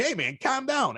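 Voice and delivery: high-pitched, mildly agitated protest; retold upset outburst. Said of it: "you sound like Joe Pesci when you get upset".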